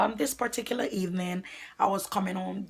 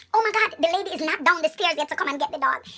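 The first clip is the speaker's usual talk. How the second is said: ordinary delivery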